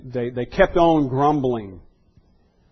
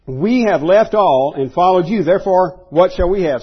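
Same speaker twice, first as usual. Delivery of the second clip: raised, louder voice of an insistent disciple; Peter; 6 semitones higher, 8 dB louder than usual. Acted. Preacher quoting the disciple's question to Jesus, raised emphatic voice. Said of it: "Peter's question was".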